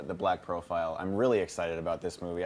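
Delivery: normal talking voice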